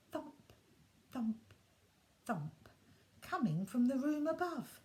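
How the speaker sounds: ordinary delivery, nothing marked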